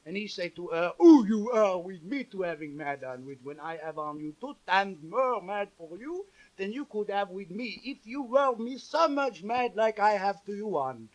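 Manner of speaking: put-on comic French storyteller accent; broken English in a heavy French accent. French chanteur introducing a song to the audience, thick French accent.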